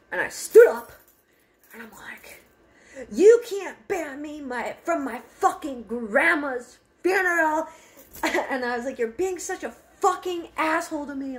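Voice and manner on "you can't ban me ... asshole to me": retold screaming fight with grandpa; yelling back. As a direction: shout it right in his face. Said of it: "him and I were yelling".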